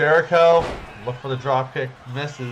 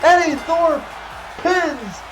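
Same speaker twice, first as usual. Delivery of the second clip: climactic shout; 10 semitones higher, slower than usual. Acted. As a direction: scream the finish like a commentator. Match-ending pin call.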